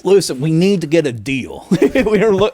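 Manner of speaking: badly faked Russian accent; a failed stab at Russian. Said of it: "that was not a Russian accent".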